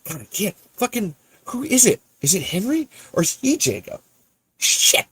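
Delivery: normal talking voice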